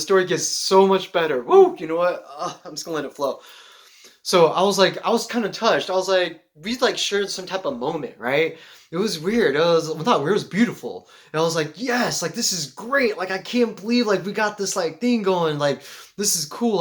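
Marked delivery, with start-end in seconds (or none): 1.4-1.8 high, loud "woo!"; sudden hyped whoop; excited whoop. Real, not acted. Excited whoop about the story. 11.8-16.8 higher, eager gushing; lightly acted excited disbelief; retold delight. Retells delight at the connection.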